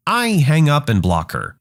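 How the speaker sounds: usual speaking voice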